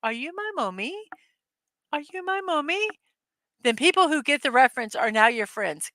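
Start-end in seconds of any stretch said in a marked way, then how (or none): 0.0-1.1 put-on high, soft, singsong child's question; eerie sing-song quote, pitch swooping; lost-child impression. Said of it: "Walk around s-, town saying". 1.9-2.9 put-on much higher, softened child's question; eerie high-pitched quote; lost-child impression. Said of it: "Walk around s-, town saying".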